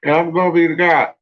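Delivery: high, pompous mock god bragging; Marduk. Marduk bragging to the people he rules, in a high, hammed-up boastful voice. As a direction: puff yourself up like a vain god, pitched high and loud. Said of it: "Marduk come over here and start talking about".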